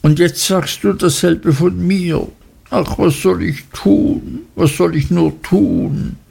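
a touch of tearful helplessness; despairing young wife. Acted. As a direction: plead helplessly, lightly. Dora despairs.

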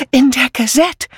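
hoch und atemlos aufgeregt; stoße es außer Atem hervor; keuchend. A narrator's reading. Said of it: "keuchte sie". (keuchend aufgeregte alte Dame)

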